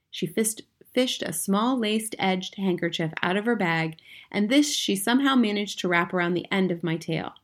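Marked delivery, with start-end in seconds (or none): none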